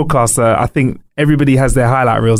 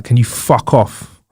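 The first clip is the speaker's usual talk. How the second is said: slow mock snub; 4 semitones lower, much slower than usual. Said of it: "Richard from Richard and Judy being like".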